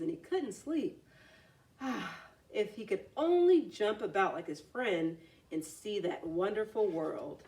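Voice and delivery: ordinary delivery, nothing marked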